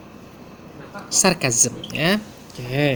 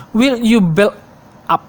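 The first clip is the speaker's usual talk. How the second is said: a sharp, louder, higher demo of a rude order; rude demand; 4 semitones higher, 4 dB louder than usual. Shown as a demonstration. Lecturer demonstrating a rude order to students, sharp and louder. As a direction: snap it out as a rude command. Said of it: "intonation and voice timbre are also important".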